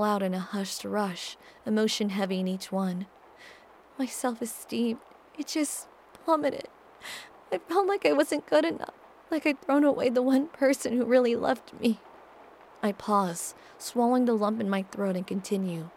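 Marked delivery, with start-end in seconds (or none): 4.0-12.0 tearful. Acted. Tearful confession of self-loathing.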